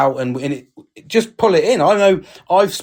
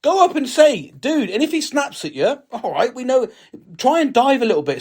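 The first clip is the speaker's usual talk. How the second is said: much higher, urgent push; impassioned; 9 semitones higher than usual. Acted. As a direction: rise up insisting he check on him. Urging to check on a quiet mate.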